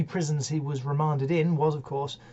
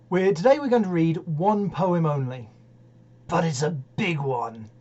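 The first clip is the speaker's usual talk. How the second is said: plain reading voice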